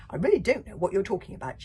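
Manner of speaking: loud, fast bluster; bellowing. Acted. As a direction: bluster it loudly. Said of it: "she bellowed".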